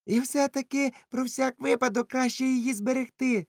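put-on high, uneasy young sailor; anxious Dick. Dick insisting on keeping the Bible, high and uneasy. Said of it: "сказав Дік".

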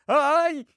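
short, high yelp; startled yelp. Acted. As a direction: cry out as if suddenly grabbed. Sucked into the bookcase.

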